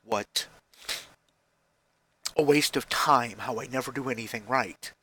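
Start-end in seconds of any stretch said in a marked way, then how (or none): none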